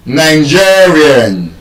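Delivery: "Nigerian" slow, GE held long; penultimate stress. Shown as a demonstration. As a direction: stretch GE in "Nigerian". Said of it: "stressed on the second to the last syllable".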